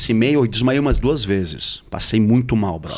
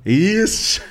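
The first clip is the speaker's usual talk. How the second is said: high, exclaimed yes; delighted agreement; 8 semitones higher than usual. Real, not acted. Co-speaker seizing on a running joke, high and excited. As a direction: burst out in gleeful agreement.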